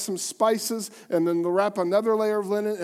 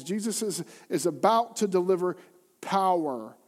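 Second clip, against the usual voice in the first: ordinary talk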